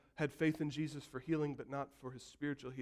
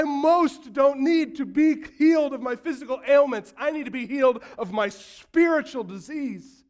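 preaching at full force; 10 semitones higher, 15 dB louder than usual. Acted. Preacher pressing the congregation, full-force preaching. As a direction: drive the point home to the church.

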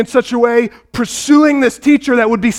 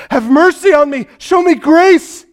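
begging cry; 6 semitones higher than usual. Retold or imitated. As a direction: plead with Jesus to heal her daughter. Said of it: "She's begging Jesus to heal".

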